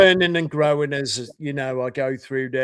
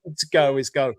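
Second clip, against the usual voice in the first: normal talking voice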